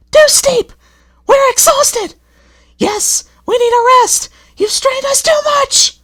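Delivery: very high, loud wailing coaches; upset coaches. A narrator's reading. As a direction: moan about the hill. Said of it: "became rather upset".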